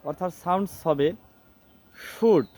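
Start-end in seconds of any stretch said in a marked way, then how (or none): 1.9-2.4 "শুড" said as shood, the l silent; correct pronunciation. Shown as a demonstration. Teacher shows how the word should sound, correctly pronounced "শুড" as shood. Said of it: "এই শব্দটি কিন্তু উচ্চারণ হবে".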